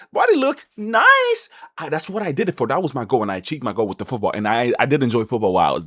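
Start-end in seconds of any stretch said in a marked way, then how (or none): 0.1-1.5 much higher, very slow show-off; smug pride. Real, not acted. Boasting about his new body, high slow smug. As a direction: savor it like a proud flex.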